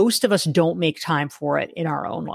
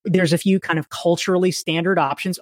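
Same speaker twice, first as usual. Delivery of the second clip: normal talking voice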